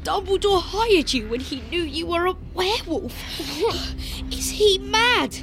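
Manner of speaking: put-on high, aghast boy's voice; incredulous outburst. A narrator's reading. Boy astonished at the headmaster's hiring, incredulous.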